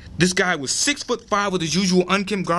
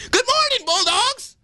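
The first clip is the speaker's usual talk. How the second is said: much higher, shouted announcer greeting; shouting; slower than usual. A narrator's reading. Boy host shouting hello to the whole school. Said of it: "After shouting".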